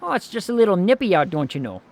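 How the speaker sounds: put-on Fargo movie accent. Shown as a demonstration. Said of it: "that accent".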